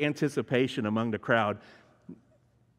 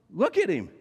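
higher and louder, insisting; urgent plea; 6 semitones higher, 3 dB louder than usual. Retold or imitated. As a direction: force them to look at him. Pilate pleading with the crowd.

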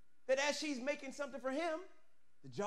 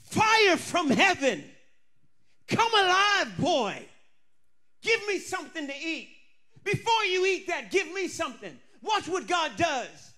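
full-force preaching; 3 semitones higher, 8 dB louder than usual. Acted. Preacher to congregation, roaring full force. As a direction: shout it like fire from heaven.

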